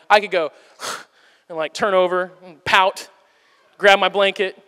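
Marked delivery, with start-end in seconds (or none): 0.1-1.6 pouty sigh. Shown as a demonstration. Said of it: "like, I'll do that".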